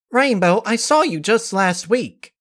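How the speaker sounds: put-on high girlish complaint; exasperated kid. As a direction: protest with an eye-roll. Kid protesting to Rainbow.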